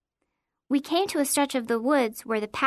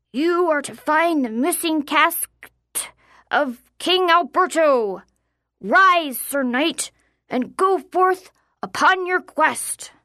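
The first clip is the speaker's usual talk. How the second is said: slow, loud, higher proclamation; mock deep knight; 4 semitones higher, 5 dB louder, slower than usual. A narrator's reading. Boy sends his friend on a quest, slow grand knight voice. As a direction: send them forth like a play-acting lord, drawn out. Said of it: "continued in his deep voice".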